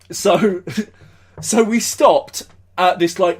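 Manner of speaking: ordinary talk, nothing marked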